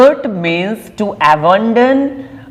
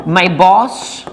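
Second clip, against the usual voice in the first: ordinary delivery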